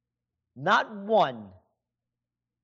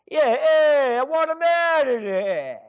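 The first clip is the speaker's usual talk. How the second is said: put-on brash, higher, louder Italian American accent on "I want him out of there"; 8 semitones higher, 5 dB louder than usual. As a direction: punch it out like a tough Italian American guy from the neighborhood. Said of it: "then he'd be Italian".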